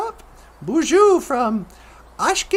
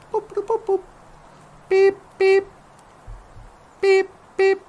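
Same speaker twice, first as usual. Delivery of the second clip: communicator beeps; 4 semitones higher than usual. Pretend. Dialing his toy communicator.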